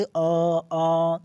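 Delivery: अ drawn out through nose and mouth; slow, long nasalised अ; nasal vowel. Shown as a demonstration. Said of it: "नाक से भी आ रहा है". (नाक से लंबा अनुनासिक अ)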